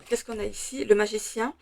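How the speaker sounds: usual speaking voice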